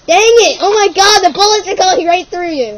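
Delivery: loud, high excited yell; excited shout. Real, not acted. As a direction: shout it out in amazement. Excited outburst during game.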